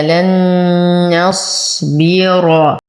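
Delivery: لن نصبر with the merged noon hummed long; very slow, sustained nasal noon in لن نصبر; full ghunna. Shown as a demonstration. Teacher modelling the merging rule, drawn-out nasal hum on لن نصبر. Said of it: "إدغام مع غنة كاملة".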